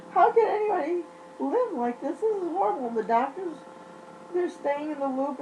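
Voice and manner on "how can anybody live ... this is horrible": high, pleading disbelief; exasperated outcry. Retold or imitated. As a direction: ask it in shocked, higher disbelief. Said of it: "I'm like".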